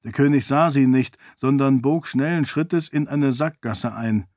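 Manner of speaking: usual speaking voice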